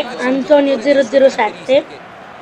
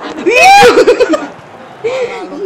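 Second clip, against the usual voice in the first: high burst of laughter; laughing; 8 semitones higher than usual. Real, not acted. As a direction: laugh out at the miss. Laughs at opponent. (risata acuta)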